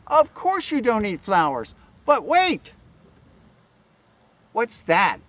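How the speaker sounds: usual speaking voice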